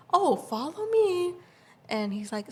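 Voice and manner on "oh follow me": high, slow sing-song usher; imitated sing-song invitation; helpful security guard. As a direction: mimic a guard, drawn-out and high. Security guard showing them to seats.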